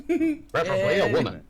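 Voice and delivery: joking high afterthought. Real, not acted.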